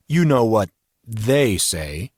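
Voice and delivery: slow line with "they" drawn out heavily; stress on they. A narrator's reading. Man answers Miss Blake's doubt, "they" heavily emphasised. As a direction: lean heavily on "they", setting it apart. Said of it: "placed a heavy emphasis on the word they".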